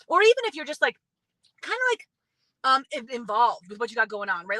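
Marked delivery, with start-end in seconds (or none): none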